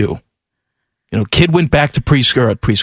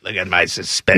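low, softer put-on old broadcaster voice; Larry King; 10 semitones lower, 5 dB quieter than usual. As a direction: drop down into a talk-host impression. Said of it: "I like you better than Larry King".

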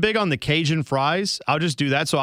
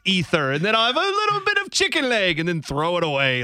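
hammed-up British accent, higher pitch; Napoleon; 4 semitones higher than usual. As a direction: play a grandly decadent royal with a put-on British accent. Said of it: "is Napoleon British now".

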